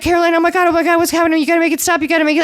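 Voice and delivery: high, panicked pleading; freaking out. Retold or imitated. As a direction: beg them to stop, frantic. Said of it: "Sergio's freaking out".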